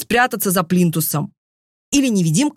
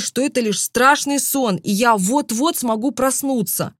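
ordinary delivery

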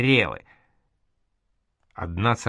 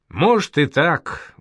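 raised, higher answer thrown out; loud reply; 5 semitones higher, 4 dB louder, slower than usual. A narrator's reading. Caravan leader answers the eavesdropper in the dark, loudly. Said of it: "громко ответил Караван-баши".

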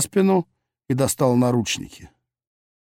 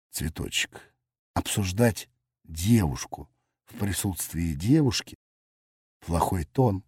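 low, grand and lordly; a soft, lofty put-down, lower and quieter; majestic; 6 semitones lower, 7 dB quieter than usual. Acted. Grandly lecturing the girl, low and soft. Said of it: "величественно сообщил".

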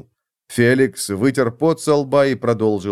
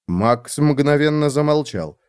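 plain reading voice

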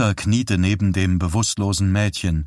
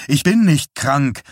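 higher, emphatic objection; protesting; 5 semitones higher, 4 dB louder than usual. A narrator's reading. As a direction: push back with a raised voice. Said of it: "protestierte Johnny". (protestierend, mit erhobener Stimme)

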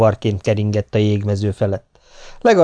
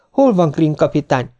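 lightly voiced woman, higher pitch; woman's voice; 7 semitones higher than usual. A narrator's reading. Woman newly arrived seeking the captain, lightly feminine voice. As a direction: lift the pitch for a hint of a woman. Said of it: "kérdezte egy női hang".

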